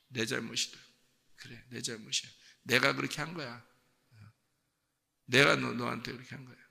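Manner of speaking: soft, subdued acceptance of blame; self-blaming parent. Acted. Parent owning a child's fault, quiet resigned self-blame. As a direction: murmur the blame as if to yourself. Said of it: "부모가 그런 마음을 갖는다".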